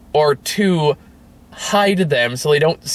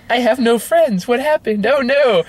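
higher, hammed-up distressed girl voice; mock whiny heroine; 10 semitones higher than usual. Mocking the heroine losing her friends, high mock whine. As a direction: whine it like a helpless movie heroine.

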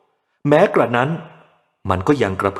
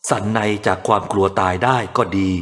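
ordinary delivery, nothing marked

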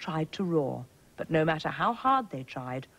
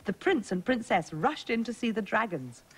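plain reading voice

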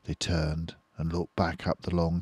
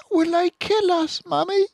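put-on scared child voice; 7 dB louder than usual. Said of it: "asked one of the small foxes".

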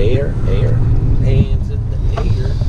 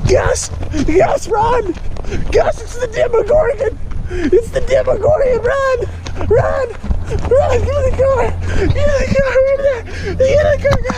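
mock panic shout. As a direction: play a horror-movie escape, yelling high. Playful Demogorgon panic in car.

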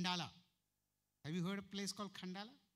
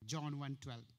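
usual speaking voice

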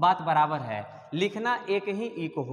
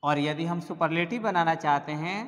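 ordinary delivery

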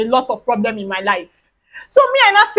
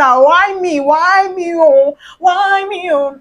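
higher, drawn-out plaintive wail; mock lament; 5 semitones higher, much slower than usual. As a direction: wail it like a playful complaint. Playful lament about lacking hair.

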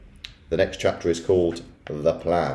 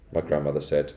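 plain reading voice

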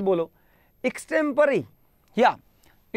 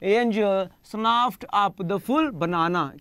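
very drawn out, stress on full; teacher models the sentence slowly; slow example sentence; much slower than usual. Shown as a demonstration. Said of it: "इस तरह से बोल सकते हो".

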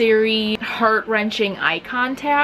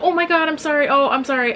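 higher, gushing mock apology; awkward, rushed-out sorry; flustered apology; 6 semitones higher, faster than usual. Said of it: "I'd be like".

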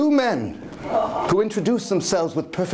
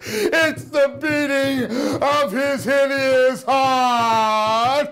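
slow, higher, louder wild cry; much higher slow frenzied cry; anguished cry; 7 semitones higher, 3 dB louder, much slower than usual. Acted. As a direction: drag it out in horror. Final confession.

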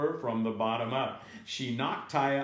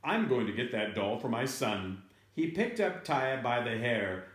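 plain reading voice

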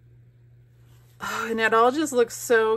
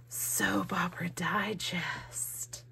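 slow, hushed whisper of delight; awed whisper; 7 dB quieter, slower than usual. Real, not acted. Vlogger finds soap magazine in gift box, awed whisper. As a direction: whisper the title slowly, thrilled.

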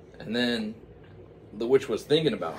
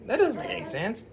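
very high, loud puzzled falsetto; high incredulous witch voice; 11 dB louder than usual. Acted. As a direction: squawk it baffled like the witch. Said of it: "the witch was like".

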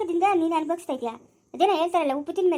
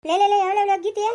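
loud, higher, repeated summons; urgent calling out; 4 semitones higher, 5 dB louder than usual. Acted. Elder calling to a woman over a crying child, urgent shout. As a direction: call out over the wailing.

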